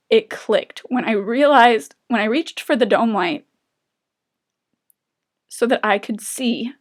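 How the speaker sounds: fighting back tears while telling it; real emotion rising in the voice; getting emotional. Real, not acted. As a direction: voice swelling with emotion, slowed. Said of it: "I'm getting emotional".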